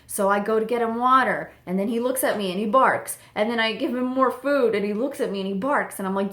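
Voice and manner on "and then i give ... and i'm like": ordinary talk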